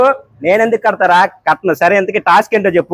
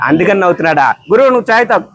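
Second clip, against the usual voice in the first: ordinary talk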